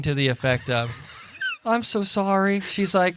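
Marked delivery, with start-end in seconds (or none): 1.6-2.6 imitating a tearful apology, higher-pitched; mock-heartbroken higher voice; tearful wife. Wife mourning the baby birds, mock-tearful. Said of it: "my wife is in tears".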